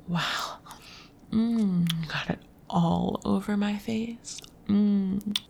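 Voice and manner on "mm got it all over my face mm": moaning. Acted. Moaning after climax.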